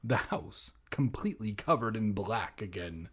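ordinary talk

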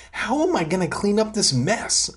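high-pitched whiny complaint; moaning. A narrator's reading. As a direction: moan it high, as if overwhelmed. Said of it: "I moaned".

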